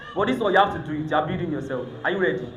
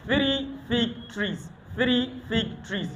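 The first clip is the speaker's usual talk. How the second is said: slow and soft, word by word; slow tongue twister demo; 2 semitones higher, 5 dB quieter, much slower than usual. Shown as a demonstration. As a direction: model it slowly for the student.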